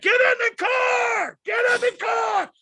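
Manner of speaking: frantic high-pitched shout, words stretched out; high, drawn-out panicked yell. Retold or imitated. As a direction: re-enact screaming a desperate warning at the screen. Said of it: "I'm yelling at the T V".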